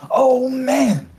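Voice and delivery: high, loud, eager tipsy greeting; excited drunk Lenny. Acted. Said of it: "He's like".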